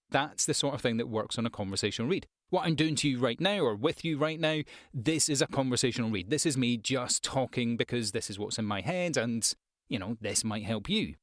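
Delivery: ordinary talk, nothing marked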